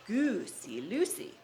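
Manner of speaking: ordinary delivery, nothing marked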